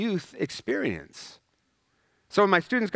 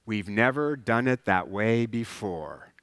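a lower, slow, stubborn mock voice; mock stubborn insider; 6 semitones lower, 3 dB quieter, slower than usual. Church insider resisting change, mock stubborn drawl. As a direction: drag it out like a stuck old-timer. Mocking a stuck dying organization.

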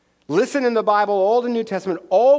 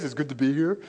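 mocking Peter; 5 semitones lower, 4 dB quieter, faster than usual. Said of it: "Okay, whatever".

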